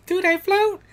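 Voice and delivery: put-on high little-child voice; kid's voice. Prompting the co-host with the kid's line, high child voice. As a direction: pitch it way up like a small kid. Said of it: "he can do a really good kid's voice".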